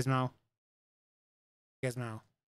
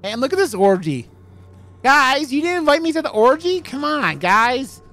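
higher and much louder, a hammed-up whine; mock whiny plea; 11 semitones higher, 12 dB louder than usual. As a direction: beg the guys to let you join, overdone. Mock whine about missed orgy.

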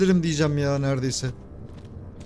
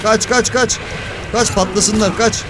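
frantic urgent shouting; being chased; 3 semitones higher, slower than usual. Real, not acted. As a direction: yell at yourself to run.

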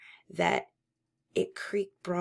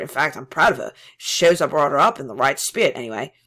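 agitated pride; 11 dB louder, faster than usual. Acted. Parsons boasts of daughter.

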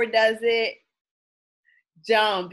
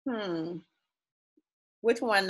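plain reading voice

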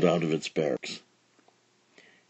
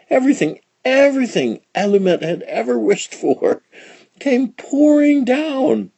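building excitement; 14 semitones higher, 3 dB louder than usual. A narrator's reading. Narrator building climax.

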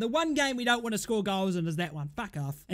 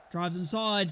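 ordinary talk, nothing marked

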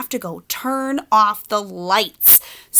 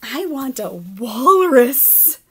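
higher, loud, slow pigeon; firm decision; 3 semitones higher, 5 dB louder, much slower than usual. Acted. Pigeon giving up on the puppy, slow and grand. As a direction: announce it grandly.

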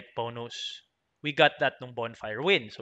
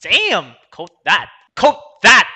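louder and higher as it goes; gleeful inner cheer; 5 semitones higher, 14 dB louder, slower than usual. Retold or imitated. Player hearing his own quotable line, loud gleeful cheer. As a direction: cheer it to himself, building to the last "Quote that!". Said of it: "I was laughing like".

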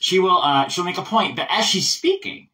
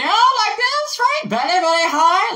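very high, drawn-out British falsetto; Margaret Thatcher; 4 dB louder, slower than usual. Shown as a demonstration. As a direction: push the voice way up high into grand British. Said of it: "same thing as we did with Margaret Thatcher".

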